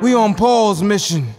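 normal talking voice

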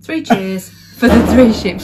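slow teasing prompt; feed the line slowly, teasing. Retold or imitated. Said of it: "You couldn't remember".

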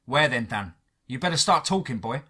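ordinary delivery

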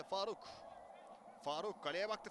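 ordinary delivery

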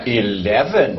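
put-on light laughing English accent. Asking the lift for a floor in English accent. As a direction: giggle through a posh English try.

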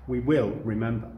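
normal talking voice

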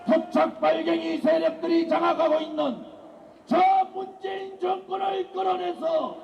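shouted political rally cry; roaring rallying speech. Real, not acted. (목청 높인 집회 연설)

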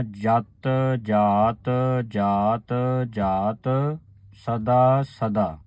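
ਜਤ ਜਾਤ ਜਾਤ drawn out very slowly, resting on ਸਦਾ; very slow recitation. Shown as a demonstration. Teacher repeating the line very slowly for the sangat. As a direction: draw out every word and stop at ਸਦਾ. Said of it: "ਇਸ ਤਰ੍ਹਾਂ ਉਚਾਰਨ ਕਰਨਾ ਹੈ".

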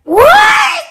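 high, loud shocked shout. Acted. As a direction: yelp it in disbelief. Shocked reply to toy demand.

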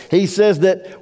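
ordinary delivery

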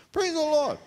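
high, hammed-up churchy praise; mock praise. Comedian mocking the weight loss, high churchy praise. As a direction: exclaim it like a revival preacher.

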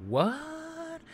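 high, startled what; shocked what. Real, not acted. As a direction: react in disbelief. Shocked by the boy's death.

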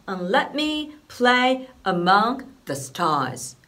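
slow, "let" and "the" short, "play" long; English rhythm. Shown as a demonstration. Teacher demonstrating the full line's rhythm, slow. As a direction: speak it slowly on the beat. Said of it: "というリズムになります".

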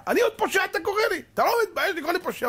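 high, indignant outcry; hurt indignation, fast and high; outraged protest. Retold or imitated. Doctor protesting to the manager, high and outraged. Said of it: "doctor outraged".

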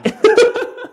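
high teasing laugh. Real, not acted. Teasing the roofer about heights, a high laugh. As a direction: giggle at the joke.

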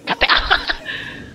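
short breathy burst of laughter; quick helpless cackle. Real, not acted. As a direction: laugh out suddenly. Laughing at the game.

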